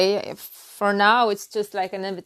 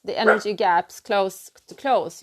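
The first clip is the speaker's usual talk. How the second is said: usual speaking voice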